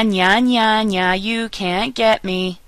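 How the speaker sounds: drawn-out teasing "nya, nya, nya"; slow mock taunt on the ñ sound; playground taunt. Shown as a demonstration. Teacher likening ñ to a childish taunt, teasing. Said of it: "Ñ sounds like ña".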